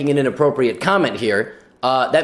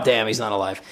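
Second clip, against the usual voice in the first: acted sinking dismay; mock letdown; 7 semitones lower, 5 dB quieter than usual. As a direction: voice drops low and soft in disappointment. Acted hope collapsing.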